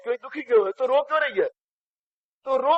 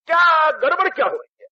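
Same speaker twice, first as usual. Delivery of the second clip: raised, higher pleading outburst; pleading shout; 7 semitones higher, 3 dB louder than usual. Retold or imitated. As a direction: call out the question to father, loud and high. Son pleads with father.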